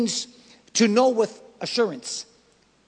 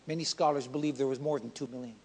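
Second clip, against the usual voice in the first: usual speaking voice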